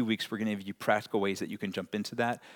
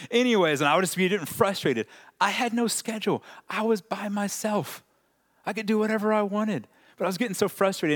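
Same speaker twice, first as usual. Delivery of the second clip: worked-up frustration; 9 semitones higher, 3 dB louder than usual. Retold or imitated. Recounting impatience on road trip.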